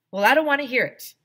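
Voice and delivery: high, loud, fast brush-off; loud refusal. Acted. Arlo cutting off Andrea's secret, loud high and fast. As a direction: blurt it out to shut her up.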